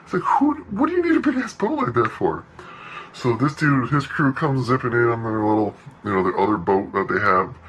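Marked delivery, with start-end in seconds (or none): 0.2-2.4 much higher, disbelieving question; can't believe how absurd it is; incredulous. Real, not acted. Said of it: "incredulous outburst".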